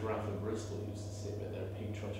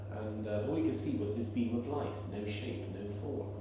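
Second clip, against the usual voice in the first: ordinary delivery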